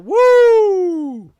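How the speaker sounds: long, high imitated blast of heat; loud whoop. Showing how hot the macaroni comes out, high whoop. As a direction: let out a piercing, sustained "woo".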